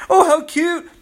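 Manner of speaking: high, laughing patronising coo; mocking condescending people. Preacher mocks people who call excited believers cute, in a high laughing coo. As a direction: laugh it off like cooing at a toddler. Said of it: "Some people say".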